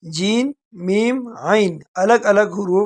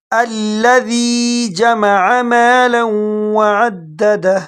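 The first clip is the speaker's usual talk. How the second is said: very slow drawn-out recitation; slow tajweed chant; Quran recitation; much slower than usual. Shown as a demonstration. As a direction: stretch every syllable in chant. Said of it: "جیسے میں پڑھ لیتا ہوں".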